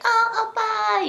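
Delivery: put-on high, plaintive girlfriend voice; caller pleading to boyfriend. Girl on a call telling her oppa her trouble, high-pitched imitation. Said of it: "she's speaking Korean, like, she was like".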